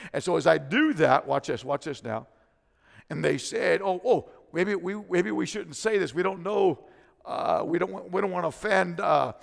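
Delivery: loud, high, rising sermon climax; full-force preaching. Acted. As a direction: preach it out, shouting to the church.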